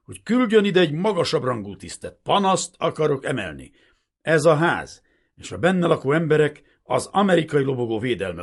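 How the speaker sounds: loud, high outraged protest; indignant officer's outburst; angry, snapping. A narrator's reading. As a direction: issue demands in a furious ringing voice. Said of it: "csattant föl a hangja".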